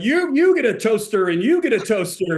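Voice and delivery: mock game-show giveaway, pitched high; caught-up giveaway excitement. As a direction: hand out prizes in a high, carried-away voice. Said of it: "allowed myself to get all caught up".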